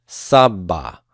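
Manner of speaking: sabba, accent on SAB; slow sabba, stressed on the first syllable. Shown as a demonstration. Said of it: "keep the accent on the first root letter".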